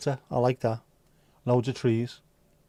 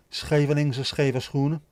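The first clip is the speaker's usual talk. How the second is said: slow, deliberate Dutch pronunciation; Dutch tongue twister; 2 semitones higher, 3 dB louder, slower than usual. Shown as a demonstration. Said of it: "Dutch tongue, tongue twisters".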